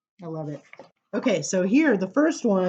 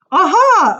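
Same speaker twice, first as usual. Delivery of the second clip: eager aha; 12 semitones higher, 13 dB louder than usual. Acted. Child in poem answers eagerly.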